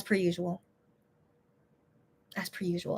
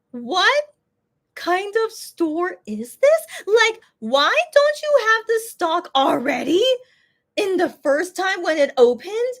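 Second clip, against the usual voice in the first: higher, slow incredulous rant; exasperated complaint; 10 semitones higher, slower than usual. Retold or imitated. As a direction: throw up your hands at the store. Exasperated retold complaint to store.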